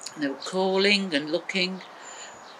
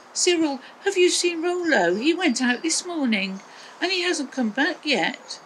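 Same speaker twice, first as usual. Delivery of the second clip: Maggie asking; 9 semitones higher than usual. A narrator's reading. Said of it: "she said".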